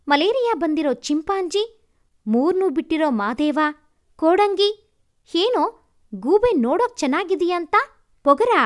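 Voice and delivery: ಕೋಪದಿಂದ ಜೋರಾಗಿ ಬೈಯುವುದು; angry scolding. Acted. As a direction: tear into him, shouting names. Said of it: "ಅದ್ ಎಲ್ಲಿತ್ತೋ ನನ್ನ ಕೋಪ".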